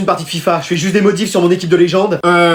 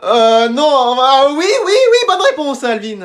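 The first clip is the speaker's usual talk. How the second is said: flustered backpedal; 6 semitones higher, much slower than usual. Acted. Teacher flustered by director's son.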